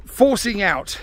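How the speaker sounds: ordinary talk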